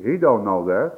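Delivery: ordinary delivery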